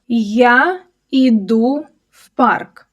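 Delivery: slow, drawn-out model reading for learners. Shown as a demonstration. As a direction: stretch each word out for a learner to follow.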